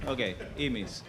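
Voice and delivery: ordinary talk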